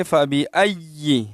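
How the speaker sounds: فَبِأَيِّ pressing hard on "ay-yi"; firm doubled ya. Shown as a demonstration. Teacher showing the shaddah, firm ya. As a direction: hold the doubled y of فَبِأَيِّ, short final i. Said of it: "سختی سے ادا کریں گے".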